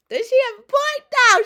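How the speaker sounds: high, loud, thrilled shout to the chat; excited shout. Real, not acted. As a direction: belt the question out, hyped. Asks chat about clip excitedly.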